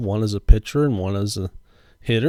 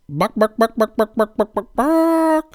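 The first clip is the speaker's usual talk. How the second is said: high squawky bark, bark, rising to a shriek; chicken noises; 10 semitones higher than usual. Pretend. Hypnotized card opener clucks like a chicken. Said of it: "I'm hypnotized again, making chicken noises".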